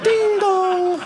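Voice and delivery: high, sing-song ding dong; sing-song doorbell. Retold or imitated. At the neighbor's door with sod, sing-song doorbell. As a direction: chime it like a doorbell, playful. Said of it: "I'm like".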